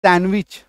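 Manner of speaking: सैंडविच as सैन-विच with silent D; correct pronunciation. Shown as a demonstration. Teacher restates the right way, correct pronunciation. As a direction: leave ड out of सैंडविच. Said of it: "ड को काट दो".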